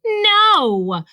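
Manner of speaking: high, loud barked refusal. A narrator's reading. Rabbit answering whether he understood Ribbit, barked. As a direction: bark it out emphatically. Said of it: "barked Rabbit".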